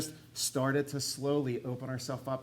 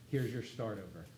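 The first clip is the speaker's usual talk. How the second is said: tender, soft; 5 semitones lower, 12 dB quieter than usual. Retold or imitated. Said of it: "he said".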